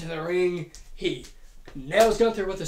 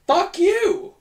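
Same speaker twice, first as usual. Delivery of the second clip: mock yell; 12 semitones higher, 7 dB louder than usual. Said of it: "promptly met with a".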